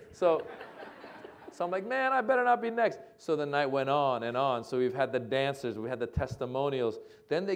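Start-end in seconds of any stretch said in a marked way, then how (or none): 1.9-3.0 high, louder comic worry; mock dread. Comedian waiting after testimonials, mock worried. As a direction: imitate nervous dread with raised pitch.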